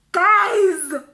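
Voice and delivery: very loud, high cry to listeners; appalled exclamation. Real, not acted. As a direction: burst out loudly in outrage. Appalled exclamation after the slap.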